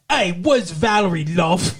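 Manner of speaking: a bad Australian accent, called out; mock Australian accent, loosely done. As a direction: butcher an Australian accent on purpose. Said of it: "imitate the butchering ... the Australian accent".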